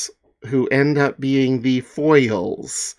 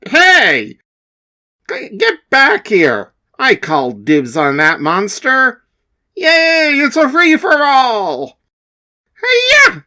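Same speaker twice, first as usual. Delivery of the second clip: excited shouting hero; 11 semitones higher, 4 dB louder than usual. Acted.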